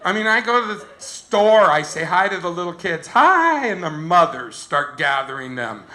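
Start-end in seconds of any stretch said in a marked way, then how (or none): 3.1-3.7 high, loud playful hi; eager greeting. Retold or imitated. Says hi to little kids at the store, high eager greeting.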